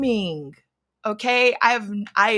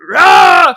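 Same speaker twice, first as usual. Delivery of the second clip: raging roar; 7 semitones higher, 12 dB louder than usual. Retold or imitated. Raging as she bursts in.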